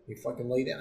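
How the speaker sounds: normal talking voice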